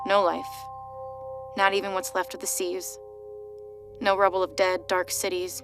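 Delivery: ordinary delivery, nothing marked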